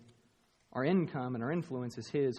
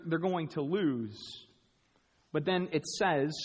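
ordinary delivery

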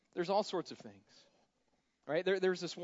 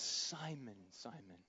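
softer, gentle calling of the name, fading away; tender call; 4 semitones lower, 8 dB quieter, slower than usual. Retold or imitated. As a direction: call him close, tenderly. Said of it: "this is tender".

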